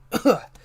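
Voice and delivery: loud sharp cough; hacking cough. Real, not acted. Tickle in throat cough.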